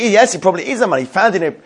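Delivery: ordinary delivery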